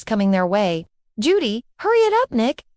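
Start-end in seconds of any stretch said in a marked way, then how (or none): 1.8-2.6 urgent. Acted. Fleeing the men, urging Nick.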